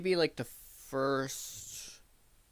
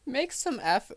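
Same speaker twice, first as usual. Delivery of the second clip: mocking imitation; 11 semitones higher, 4 dB louder, slower than usual. Mocking the host's words.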